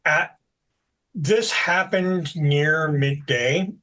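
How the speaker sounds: ordinary talk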